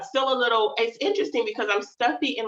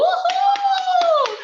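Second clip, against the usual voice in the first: high, loud whooping cheer; excited cheer; 6 dB louder than usual. Real, not acted. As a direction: let out a joyful whoop to open the show. Host cheers opening the show.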